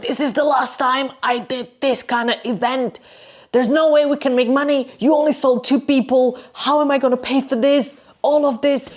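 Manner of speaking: high, strained mock rant; acted high-pitched tirade; imitated angry shouting. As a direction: rant like a furious boss without full volume. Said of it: "like, shouting. He was shouting".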